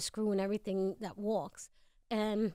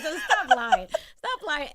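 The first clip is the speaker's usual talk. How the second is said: laughing; 6 semitones higher, 13 dB louder, slower than usual. Retold or imitated. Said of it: "I said".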